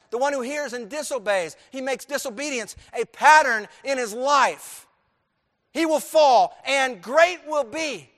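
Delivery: loud, ringing preacher's cry; booming pulpit proclamation; preaching at full force. Acted. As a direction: hammer the hymn line home to the church.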